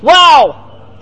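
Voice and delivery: loud, high burst of amazement; awestruck exclamation. Retold or imitated. Teacher marvels at the rabbi's new insight, awestruck.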